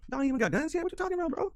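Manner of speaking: can't believe what the game says; incredulous. Real, not acted. Disbelief at game text.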